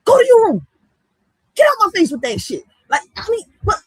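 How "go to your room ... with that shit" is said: loud, high scolding yell; yelling at a kid. Pretend. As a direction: snap at a kid to go to their room. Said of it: "I should be like".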